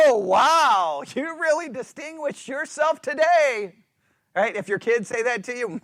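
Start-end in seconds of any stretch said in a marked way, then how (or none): 0.1-3.7 higher, mocking fake praise; teasing sarcastic wow. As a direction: sarcastically congratulate someone. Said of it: "So someone can go".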